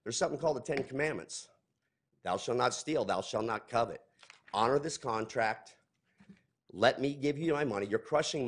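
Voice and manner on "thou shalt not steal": normal talking voice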